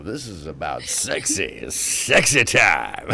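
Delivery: slow, low mock-seductive drawl; mock sultry. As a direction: stretch sexy time out, teasing. Drawling about sexy time.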